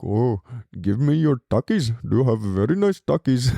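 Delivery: mock Indian-accented prison gang member; a hint of an Indian accent, teasing and demanding. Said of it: "like, India and he's like".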